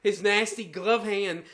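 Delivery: ordinary talk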